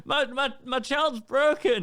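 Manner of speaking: high, loud, slow, laughing lament; mock wail through laughter; laughing mock despair. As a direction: ham up the tragedy. Mock despair over child.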